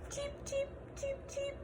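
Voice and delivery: very high, soft little peeps; baby chick peeps. Acted. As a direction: peep like tiny newborn chicks. Baby chicks have hatched.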